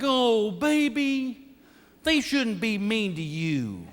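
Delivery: slow, sweet mock-tender bride; mock cooing bride. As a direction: coo it slowly like a doting new wife. New bride cooing to husband.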